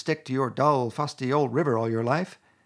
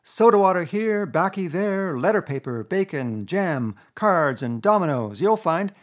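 higher, louder, showing-off Toad; boastful Toad; 7 semitones higher, 5 dB louder than usual. A narrator's reading. Toad showing off supplies to Mole, boastful. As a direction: reel off his treasures proudly.

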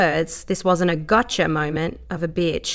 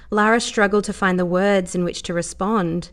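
ordinary delivery, nothing marked

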